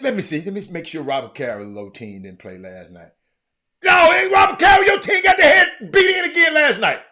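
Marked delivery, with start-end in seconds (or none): 3.8-7.0 higher, louder taunting roast; teasing shout. Real, not acted. Host taunting a viewer whose team lost, loud teasing shout. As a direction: yell it out to rub the loss in.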